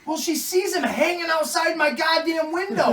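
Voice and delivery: high, loud incredulous shouting; exasperated rant. Real, not acted. Dad about Santa outside his window, exasperated rant. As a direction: shout it in comic outrage.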